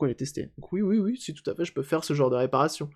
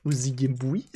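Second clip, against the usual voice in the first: usual speaking voice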